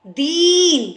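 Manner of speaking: "दीन" with a long, drawn-out ee; stretched vowel in "दीन". Shown as a demonstration. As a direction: hold the ee in "दीन" for emphasis. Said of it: "तो बड़ी आवाज़".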